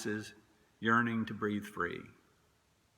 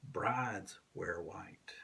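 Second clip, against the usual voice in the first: slow, breathy whisper of the line; whispered, reverent and slow; hushed whisper; 4 semitones lower, 8 dB quieter, much slower than usual. Acted. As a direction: let it fall to a whisper.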